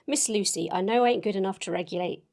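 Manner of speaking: ordinary delivery, nothing marked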